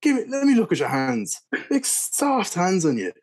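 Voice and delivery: very Irish impression. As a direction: scoff like an Irish local sizing up a softie. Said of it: "this would be a very Irish impression".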